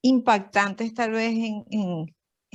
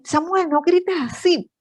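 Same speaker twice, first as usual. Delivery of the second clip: imitating a husband scolding, higher and sharper; mock the dad telling the boy off, pitched up; scolding father; 7 semitones higher than usual. A wife imitates her husband scolding their son, mock stern.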